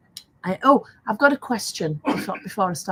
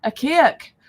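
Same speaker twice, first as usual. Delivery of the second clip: "cake" pitched up in badly faked Geordie; bad Geordie accent; 6 semitones higher, 5 dB louder than usual. Granny repeating her granddaughter's word, higher badly faked Geordie. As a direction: have another go at Geordie on "cake". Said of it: "my Geordie accent's pants".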